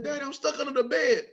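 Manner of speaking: high-pitched mock kid voice; pleading child. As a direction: play a stuck kid calling for Daddy. Voices himself as stuck child.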